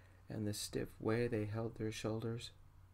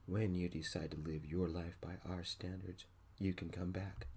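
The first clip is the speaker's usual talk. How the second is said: usual speaking voice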